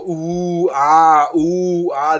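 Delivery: a loud crowd going ooh and ah. Retold or imitated. Vlogger imitates the crowd reacting to punches, loud oohs. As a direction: react like a stunned fight crowd. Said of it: "everybody's gonna go".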